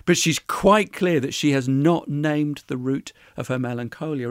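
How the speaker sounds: usual speaking voice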